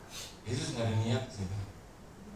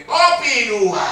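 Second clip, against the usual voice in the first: loud, slow, higher bark; loud order; 11 semitones higher, 14 dB louder, much slower than usual. Retold or imitated. Husband loudly orders two coffees, slow loud bark. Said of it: "Ternyata dia cuma begini".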